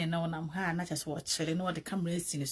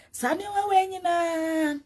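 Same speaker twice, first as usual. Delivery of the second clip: drawn-out exclamation; 10 semitones higher, 5 dB louder, slower than usual. Real, not acted. Exclaims loudly.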